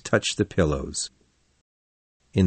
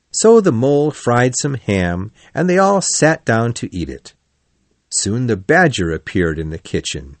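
plain reading voice

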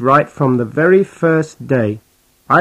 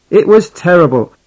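normal talking voice

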